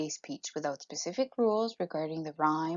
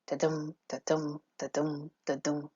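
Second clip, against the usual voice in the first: "Da-dum" with a light da and a stressed dum; low, even "da-dum" pulse, weight on each dum; iambic heartbeat beat; 3 semitones lower, 4 dB quieter than usual. Shown as a demonstration. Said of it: "So listen to this".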